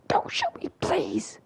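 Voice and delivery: soft, whispery high plea; whimper-like whispered begging, hammed up; mock begging. Villain begging Joker for his life, hushed mock plea.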